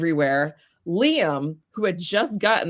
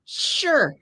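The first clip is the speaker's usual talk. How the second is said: dubious agreement; 5 semitones higher, 5 dB louder, much slower than usual. Retold or imitated. Sceptical retold agreement.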